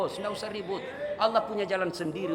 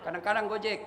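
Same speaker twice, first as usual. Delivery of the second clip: ordinary talk, nothing marked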